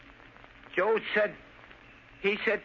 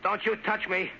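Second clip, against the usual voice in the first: normal talking voice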